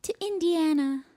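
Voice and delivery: lightly voiced whiny princess; a hint of a sulky princess; cartoon princess. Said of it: "That's how he sounds".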